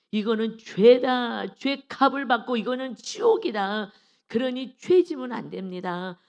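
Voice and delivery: loud, slow, emphatic warning; firm warning. Acted. Preacher telling people plainly that it is sin, firm warning. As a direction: draw out each charge with rising force. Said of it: "정확히 알려줘야 돼요".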